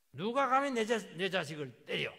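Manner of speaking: loud, high outraged yell; furious shout. Acted. Father shouting at whoever hit his child, furious yell. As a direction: roar it like an enraged parent. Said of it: "막 소리부터 치고 봐요".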